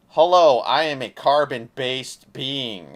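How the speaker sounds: loud, slow hammed-up robot hello; mock robot greeting. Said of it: "it's cheapening".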